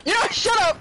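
playful shout. Real, not acted. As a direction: shout it laughing at a friend. Playful yell at friend.

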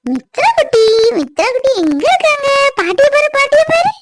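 put-on very high sing-song baby talk; cooing to baby. Grandmother calling baby to look at her, high cooing. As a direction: squeak sweetly to catch a baby's attention.

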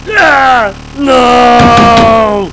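loud wailing as the screen dies; anguished screaming. Real, not acted. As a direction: scream in despair at the crash. Computer crashes to blue screen.

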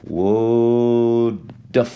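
would've stretched as "wooo-duv"; slow contracted demo. Shown as a demonstration. Teacher showing what natives say, drawn-out would've. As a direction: draw out would've in two slow syllables. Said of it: "dicen esto".

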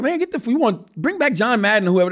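put-on hamming up irritated viewers demanding the old announcer; annoyed fans. Fans griping about the new announcer, mock-annoyed outcry. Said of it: "They were like".

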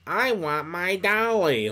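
slow, high put-on child voice; little girl. Little Lucy wanting her doll, babyish high voice. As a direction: whine it out slowly, high like a small child. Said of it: "little girl will be kinda like".

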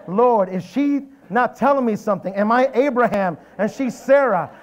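high, loud alarmed plea; comic storytelling alarm; retold panic. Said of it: "My heart is racing".

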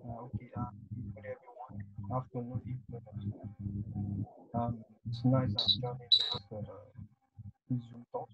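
very faint, distant mic voice; speaking far too softly; barely audible. Real, not acted. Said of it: "Your voice is very low".

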